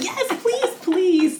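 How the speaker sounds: high-pitched begging, imitating eager fans; eager pleading. Acting out excited men begging.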